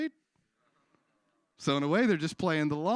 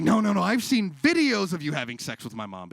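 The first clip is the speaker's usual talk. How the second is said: exasperated yell; 5 semitones higher, 8 dB louder than usual. Acted. Son insisting louder.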